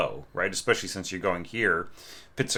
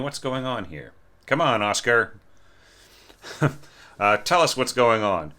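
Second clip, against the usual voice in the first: mock scolding; 3 semitones higher than usual. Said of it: "No, just kidding".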